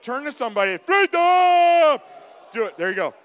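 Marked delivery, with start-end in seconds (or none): 0.8-2.0 roaring battle cry, high and drawn out; long, high, full-throated yell; William Wallace. Pretend. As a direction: bellow it like a warrior leading a charge. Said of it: "with your best William Wallace voice".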